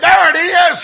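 high, loud triumphant call; a retold shout of discovery; loud exclamation. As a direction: shout it out like you found it. Farmer finds number, exclaims.

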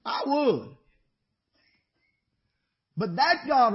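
loud, high, ringing preacher's cry; preaching at full force. Real, not acted. Preacher lifting the congregation with a loud, high cry.

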